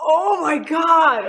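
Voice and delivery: high, slow drawn-out gasp of disbelief; scandalized reaction to juicy gossip; scandalized shock. Real, not acted. Shocked at the whispered secret.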